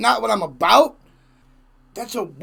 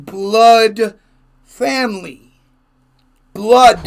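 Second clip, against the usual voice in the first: "blood" punched slowly, louder than the rest; stress on blood; 3 semitones higher, 8 dB louder, much slower than usual. Real, not acted. Insisting his relatives are literally kin, emphatic loud stress on "blood". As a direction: hit "blood" hard, drawn out and loud.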